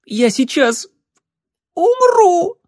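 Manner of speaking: very high squeaky gasp of a voice; squeaking. Acted. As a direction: squeak it out, breath squeezed out by a corset. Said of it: "пропищала Эбби из последних сил".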